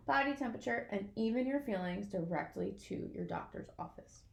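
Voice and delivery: ordinary delivery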